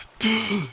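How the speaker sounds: sharp gasp surfacing from icy water; shocked gasp from cold. Acted. Narrator surfacing in freezing water, sharp gasp. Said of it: "gasping from the shock".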